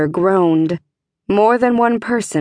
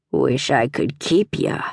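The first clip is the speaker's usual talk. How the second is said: low, soft grating voice; grating stablemaster; 6 semitones lower, 3 dB quieter than usual. A narrator's reading. Stablemaster to the trapped girl, low grating voice. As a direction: scrape the words out low and greedy. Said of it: "the man's voice grated".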